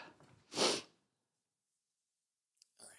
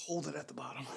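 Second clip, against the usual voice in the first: strained whisper mid rep; 3 semitones higher, 6 dB quieter, faster than usual. Real, not acted. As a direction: whisper it through the effort. Coaching during a rep.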